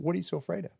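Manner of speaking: soft, hushed retelling; understated quiet probe; gentle question. Retold or imitated. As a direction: ask it quietly, well under normal volume.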